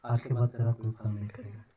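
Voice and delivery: soft, muttered curse under the breath; a quiet, low-key curse on the fig tree. Shown as a demonstration. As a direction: lay the curse on it softly, almost to yourself. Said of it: "ऐसे बोला होगा".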